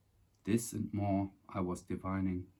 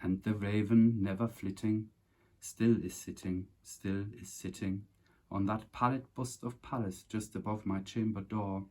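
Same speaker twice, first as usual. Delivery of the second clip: dramatic, measured reading of The Raven; smooth poem recital. Acted. Reciting Poe's Raven to viewers in a smooth dramatic voice. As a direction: recite it smooth and solemn, savouring each line. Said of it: "so that was the smooth one".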